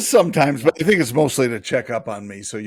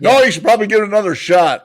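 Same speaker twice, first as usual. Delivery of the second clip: mock sarcastic reply; 8 semitones higher, 9 dB louder, faster than usual. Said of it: "the best question she ever had".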